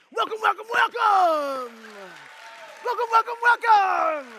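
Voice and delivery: high, loud, ringing welcome call; shouted welcome. Real, not acted. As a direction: whip up a big welcome for him. Welcoming the gentleman on stage.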